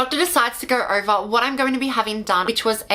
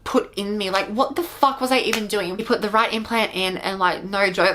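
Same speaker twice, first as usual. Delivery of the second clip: ordinary talk, nothing marked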